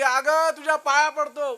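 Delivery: husband begging his wife not to go; desperate begging. Acted. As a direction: plead at her feet, desperate.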